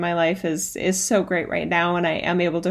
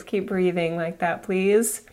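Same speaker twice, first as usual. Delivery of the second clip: guided meditation; 3 semitones lower, 3 dB quieter than usual. Acted. Guides elevator visualization meditation.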